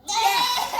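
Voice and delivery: loud, high burst of relief; relieved cheer. Real, not acted. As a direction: shout it out in relief. Climber held up by books.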